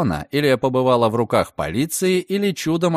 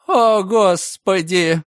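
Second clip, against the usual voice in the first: slow, louder gasp of dismay; shocked gasp; 6 semitones higher, 4 dB louder, much slower than usual. A narrator's reading. Landlady shocked reading the warrant, slow loud gasp.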